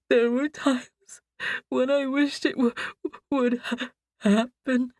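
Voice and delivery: faltering tearful mumble from a dream; broken mumble. A narrator's reading. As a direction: stumble through it half asleep. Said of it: "barely intelligible".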